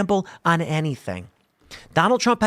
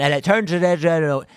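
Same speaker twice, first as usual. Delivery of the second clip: weird de-essed sound; slower than usual. Shown as a demonstration. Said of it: "That's what I was just doing right now".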